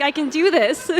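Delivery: psyching herself up; determined. Retold or imitated. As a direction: alone in New York, cheering herself on. Self-pep talk, laughing.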